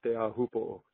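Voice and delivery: Teahupo'o as tay-ah-hoo-po-oh; native-style Teahupo'o; proper pronunciation. Shown as a demonstration. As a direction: model Teahupo'o evenly with a long final o. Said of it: "Proper way to say it is".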